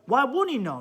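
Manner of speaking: put-on young comic ranting to his girlfriend about a booker; indignant rant. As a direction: huff it out like you've been wronged. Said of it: "I'd sit there and go".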